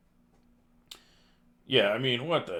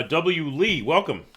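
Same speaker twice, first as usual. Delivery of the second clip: ordinary delivery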